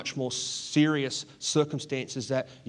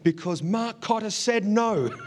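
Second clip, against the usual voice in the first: high, loud call of a clueless tattletale; dim loudmouth; 8 semitones higher, 4 dB louder than usual. Retold or imitated. Some moron answering the gang from inside, high foolish shout. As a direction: blurt it out high like a fool. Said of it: "some moron said".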